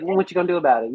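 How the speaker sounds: ordinary talk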